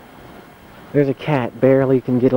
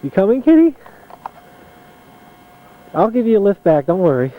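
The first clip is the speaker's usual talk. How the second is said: high, louder coaxing call; calling the cat; 7 semitones higher, 5 dB louder than usual. Real, not acted. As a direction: coax the kitty along, high. Coaxing cat through snow.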